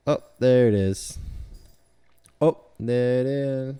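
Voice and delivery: loud, excited discovery. Real, not acted. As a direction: call it out happily. Excited at finding it.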